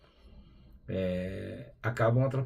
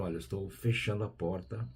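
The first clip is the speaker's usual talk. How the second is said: talking to oneself; 2 semitones lower, 9 dB quieter than usual. Acted. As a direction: quietly tell yourself, trailing down to very soft. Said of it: "falar pra mim mesmo".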